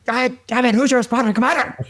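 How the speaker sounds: very high mock yell of an irate general; retold furious demand; mad general. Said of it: "and he's mad".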